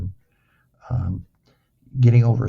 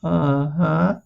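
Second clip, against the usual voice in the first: higher, rising put-on uh-huh; humouring uh-huh; 4 semitones higher than usual. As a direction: play along doubtfully, voice lifting. Mock-agreeing to young therapist in story.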